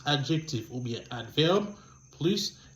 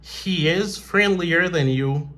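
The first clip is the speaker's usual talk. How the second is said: slow dictation; 3 semitones higher, 3 dB louder, slower than usual. Shown as a demonstration. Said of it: "Il est plus amical que toi".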